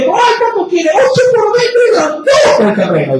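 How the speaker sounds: hammed-up excited boast; mock boastful neighbours. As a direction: imitate people showing off a big plot of land.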